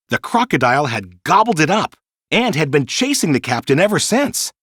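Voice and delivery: plain reading voice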